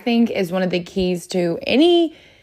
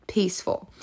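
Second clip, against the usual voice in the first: whispered; 6 dB quieter than usual. Acted. Whispers the calm feeling word.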